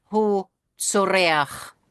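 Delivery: "Hu tsoreach" said slowly, each syllable drawn out; slow careful demo. Shown as a demonstration. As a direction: spell out "Hu tsoreach" slowly for the class. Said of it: "tää on feminiini".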